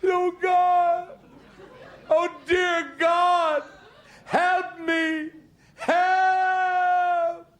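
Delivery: high, drawn-out wail for help; desperate pleading. Acted. Man on a branch begs God, high desperate wail. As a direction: plead skyward in a long strained cry.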